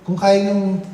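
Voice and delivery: usual speaking voice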